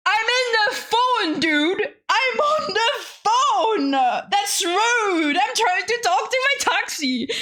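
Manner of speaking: high-pitched, exasperated whine; retold outburst, words stretched in a high pitch; overwhelmed protest. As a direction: plead indignantly in a high, overwhelmed voice. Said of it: "I was so overwhelmed".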